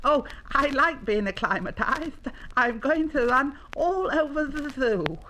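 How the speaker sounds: high, excited little animal voice; gleeful young llama. Acted. Young llama announces a run round the zoo, gleeful. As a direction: bubble over into a giggle.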